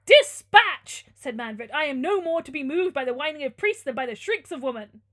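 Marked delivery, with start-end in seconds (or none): 0.1-1.1 high, very loud order; shouted command. Acted. Villain orders an execution in story. 1.7-5.0 loud, scornful tyrant; imperious Manfred. Acted.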